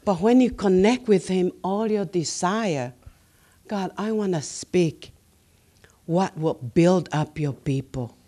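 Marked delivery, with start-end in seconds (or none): none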